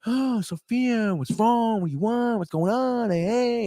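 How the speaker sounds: mock-concerned doctor, words stretched out; doctor impression. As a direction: put on a fussing, high-pitched doctor. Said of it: "We're in the doctor".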